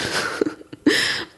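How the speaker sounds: brief, soft chuckle; a short laugh between remarks; amused laugh. Real, not acted. Interviewee amused by odd-looking mussels, small laugh.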